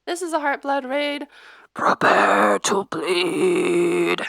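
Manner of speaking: slow menacing growl on the last words; drawn-out threat; scary voice. Shown as a demonstration. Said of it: "you have to say it in that, like, scary voice".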